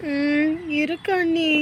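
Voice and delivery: slow, reluctant sulky agreement. Acted. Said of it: "முகத்தை உம்முனே வச்சுக்கிட்டு".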